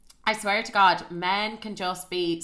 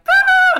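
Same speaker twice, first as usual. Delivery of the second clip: high, loud excited squeal; excited scream; 15 dB louder than usual. Retold or imitated. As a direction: shriek it like a thrilled girl gang. Friends react to his phone call.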